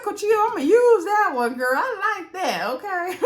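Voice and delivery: high, excited squeal of approval; playfully delighted. Retold or imitated. Recapper cheering on Cochia's flirting move, playfully delighted.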